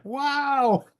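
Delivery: long, high drawn-out wow; mock amazement. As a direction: act astonished at what you heard. Acts out amazed reaction to people.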